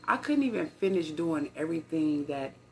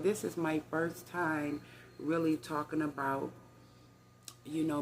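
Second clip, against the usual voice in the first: usual speaking voice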